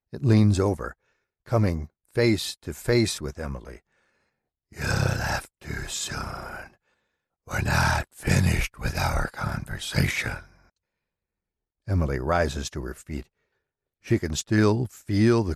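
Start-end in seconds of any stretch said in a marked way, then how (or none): 4.7-10.4 low, slow, whispery shadow creature; menacing low spirit voice; dark entity voice. Acted. The entity speaking.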